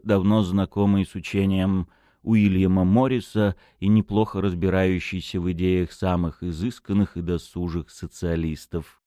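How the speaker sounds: plain reading voice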